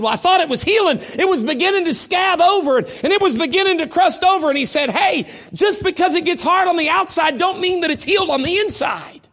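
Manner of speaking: loud, high preacher's cry; full-force preaching. Acted. Preacher retelling the doctor to his congregation, loud and high. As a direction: preach it out to the church.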